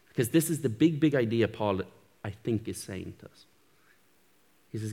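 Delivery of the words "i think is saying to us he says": normal talking voice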